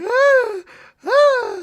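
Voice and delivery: high, loud hammed-up screaming; shrill playful fake screams; mock screams. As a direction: imitate her screaming from a nightmare, over the top. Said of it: "and she's like".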